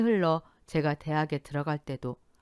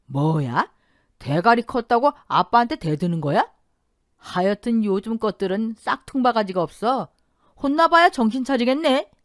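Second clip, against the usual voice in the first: louder, angry scolding yell; shouting father; 5 semitones higher, 7 dB louder than usual. A narrator's reading. Father yelling at his daughter for talking back. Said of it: "소리를 질렀어요".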